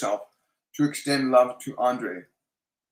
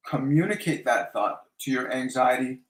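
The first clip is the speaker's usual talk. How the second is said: ordinary talk, nothing marked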